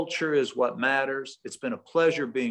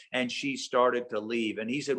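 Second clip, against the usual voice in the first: ordinary delivery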